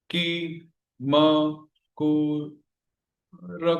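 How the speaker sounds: held single syllables, long pauses between. Shown as a demonstration. Teacher sounding out the last line to count, syllable by syllable. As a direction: give each syllable its own slow beat. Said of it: "आठ ही हो गए".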